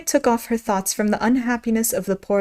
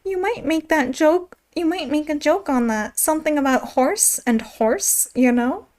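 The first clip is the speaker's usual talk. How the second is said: high, small gnat voice; tiny insect voice; 6 semitones higher than usual. Acted. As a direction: speak like a tiny bug at her ear. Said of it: "an extremely small voice close to her ear".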